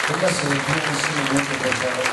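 rally the congregation to stand and pray; fervent altar call; preaching at full force. Acted. Calls congregation to prayer.

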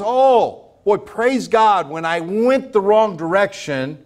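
normal talking voice

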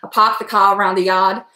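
put-on stock Boston accent, dropped R's; Bostonian accent. Quoting what Bostonians always say, in a Boston accent. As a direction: imitate Boston: "pahk the cah". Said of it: "your Bostonian accent".

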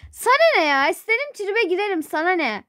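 defiant snapping. Acted. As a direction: snap back, touchy and offended. Snaps back at family. (tersleyerek, tiz sesle çıkışma)

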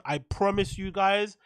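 normal talking voice

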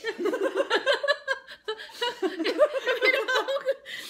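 long, high, loud laughter. Real, not acted. Laughing at video joke.

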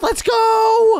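high, loud cheer; excited shout. Real, not acted. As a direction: cheer it out at the top. Racing game, cheering on.

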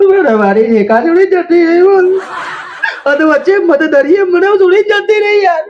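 high-pitched tearful whine; hammed-up crying. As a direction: wail like a dumped lover. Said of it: "પેલો કેમ રોઈ રહ્યો હો". (રડમસ ઊંચા અવાજે ફરિયાદ)

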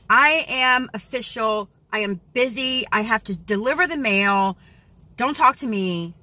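a hint of a busy, important person; lightly hammed-up busy official; mock official. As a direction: play a self-important mail carrier. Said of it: "These are my official business glasses".

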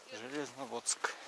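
ordinary talk

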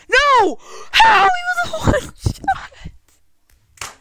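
screaming in disbelief, very high and loud; raging gamer. Real, not acted. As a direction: howl at the loss, voice cracking high. Loses the final fight.

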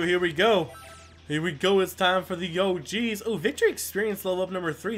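ordinary talk, nothing marked

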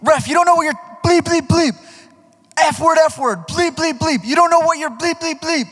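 hammed-up furious player yelling; screaming heckler. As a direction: imitate the kid screaming in his face. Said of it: "This one kid keeps walking up to me".

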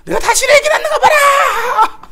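very high, drawn-out howl; acted-out agonised scream; screamed labour wail. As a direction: bellow it slowly like a woman in labour. Wife screaming through labour pains.

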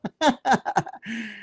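real amused laugh; short burst of light laughter; chuckle. Real, not acted. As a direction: chuckle after your own joke. Laughs after forbidden number joke.

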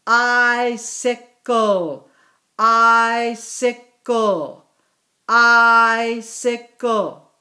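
very slow, each syllable of Icicle drawn out; slow clear model. Shown as a demonstration. Teacher to class, modelling the word slowly. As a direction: stretch Icicle so every sound is heard. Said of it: "Our next word".

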